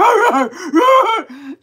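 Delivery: loud, high imitated dog barks; insane dog barking. Imitating Mai Tai barking at the bathroom, frantic barking. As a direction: bark like a crazed guard dog. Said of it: "starts barking like an insane dog".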